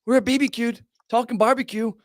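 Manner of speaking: lightly voiced cheery podcast host; mock podcast host intro. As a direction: hype it like a show opener. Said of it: "Chris from B B Q Productions every week talking".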